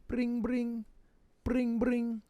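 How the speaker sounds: mouthing a phone ringing, high and soft; imitated phone ring. Phoning Grayson, ringing tone.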